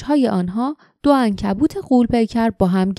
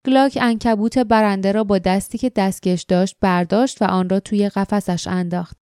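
plain reading voice

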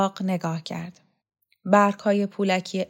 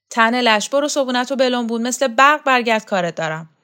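loud, raised-pitch scolding order; shouting; 5 semitones higher, 7 dB louder than usual. A narrator's reading. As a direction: shout orders at a lazy underling. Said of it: "فریاد زد". (با فریاد و تشر)

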